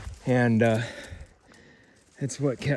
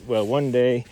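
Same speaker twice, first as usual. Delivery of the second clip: usual speaking voice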